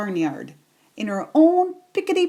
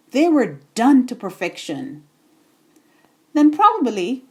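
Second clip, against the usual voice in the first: ordinary delivery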